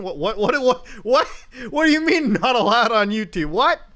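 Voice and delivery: loud rising disbelief; stammering outrage at the rule; incredulous. Real, not acted.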